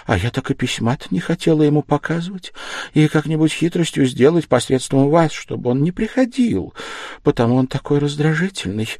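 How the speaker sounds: put-on high, urgent young woman's voice, explaining quickly; a worried daughter pleading her case; young daughter Dunya. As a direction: daughter voiced well above the narrator, troubled. Dunya speaking to her mother.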